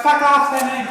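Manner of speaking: high, loud Irish brush-off; laughing mock Irish, loud and high; Irish accent. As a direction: say it in a cheeky Irish brogue. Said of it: "fake Irish accent".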